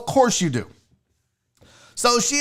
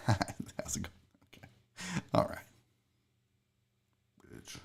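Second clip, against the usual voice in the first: very soft, barely voiced small talk; hushed two-faced pleasantries; muttered fake politeness; 11 semitones lower, 17 dB quieter than usual. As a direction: mumble niceties, then slip the insult under your breath. Fake politeness, then muttered insult.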